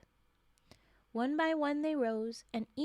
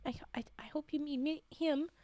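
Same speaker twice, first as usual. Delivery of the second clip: whispered stammer. Real, not acted. Teasing whispered mistake.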